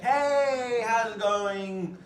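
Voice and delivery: high, loud, drawn-out hello; big sing-out greeting with swooping pitch; excited friend greeting. Shown as a demonstration. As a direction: greet a long-missed friend, stretched and high. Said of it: "that high pitch and that low pitch".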